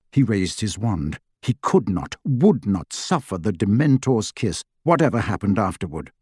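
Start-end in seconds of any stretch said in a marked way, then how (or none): none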